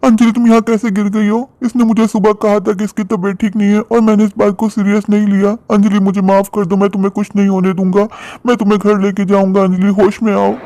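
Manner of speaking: high, alarmed calling of her name; frantic husband over his fallen wife; panicked alarm. Acted. (घबराई हुई ऊँची आवाज़ में पुकार)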